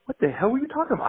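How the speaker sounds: high mock-baffled wife voice; imitating wife. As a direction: pitch it up like his puzzled wife. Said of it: "She goes".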